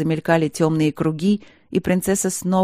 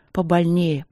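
ordinary delivery, nothing marked

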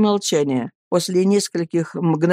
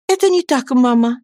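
put-on a higher, earnest young wife's voice; Annie pleading; 6 semitones higher, 4 dB louder than usual. Young wife begging her friends to speak, pleading.

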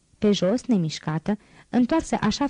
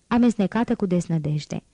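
plain reading voice

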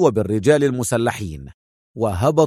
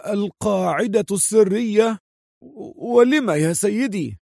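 high-pitched puzzled question; taken aback, pitch lifted; astonished muttering; 7 semitones higher than usual. A narrator's reading. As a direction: ask it in amazement, in the hoarse henchman's raised voice. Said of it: "فتح موريس عينيه في دهشة وتمتم".

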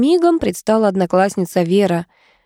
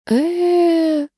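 high, drawn-out hesitant uhh; stall, unsure what to say; 10 semitones higher than usual. Acted. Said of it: "замялась я".

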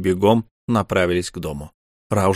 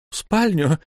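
mocking; 8 semitones higher than usual. A narrator's reading. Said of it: "спросила она насмешливо".

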